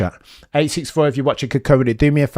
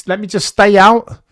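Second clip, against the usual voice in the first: high, pleading driver's radio whine; high mock plea to stay out on track; mock Leclerc plea; 8 semitones higher, 5 dB louder than usual. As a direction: beg to stay out like the frustrated driver. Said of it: "He's fuming".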